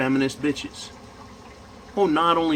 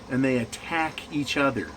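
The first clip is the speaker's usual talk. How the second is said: usual speaking voice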